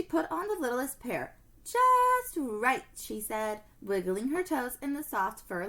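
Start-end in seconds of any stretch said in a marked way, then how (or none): 1.6-2.8 delighted. Acted. Happy with boots.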